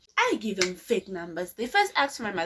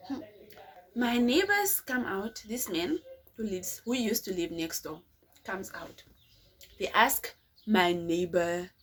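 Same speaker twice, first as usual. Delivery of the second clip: ordinary talk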